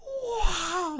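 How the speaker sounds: a hammed-up shriek of delight; cartoonish mock amazement; mock wow. Acted reaction in a retold scene.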